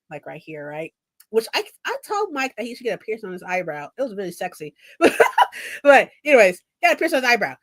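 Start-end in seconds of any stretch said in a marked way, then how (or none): 5.0-5.8 high breathy giggle; burst of laughter. Real, not acted. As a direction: laugh out loud. Laughing at own comment.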